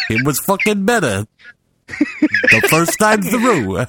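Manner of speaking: mock someone's slow delivery, stretching every word; comically sluggish imitation breaking toward laughter; very slow mimicry. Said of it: "he's saying it very slowly".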